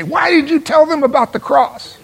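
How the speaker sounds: lightly hammed-up reproach; scolding gospel police. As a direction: play the nitpicking church critic. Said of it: "come around behind you and say".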